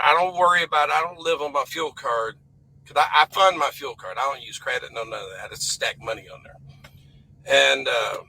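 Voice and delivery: normal talking voice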